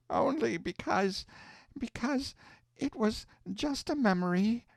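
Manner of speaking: put-on weeping old aunt, voice breaking; tearful aunt. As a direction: hold back crying as you answer. Hannah weeps at mother's words.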